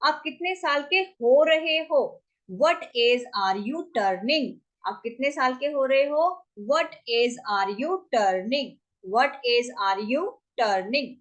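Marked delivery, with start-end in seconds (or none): 2.5-11.1 drawn-out teaching delivery; slow demo phrase; slow model phrase. Shown as a demonstration. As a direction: stretch it so learners can follow. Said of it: "teacher models English question".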